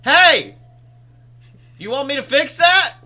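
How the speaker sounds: loud, high holler from a passing car; calling out. Retold or imitated. Stranger offers a driver a repair, hollered. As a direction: shout it across traffic, imitating a stranger.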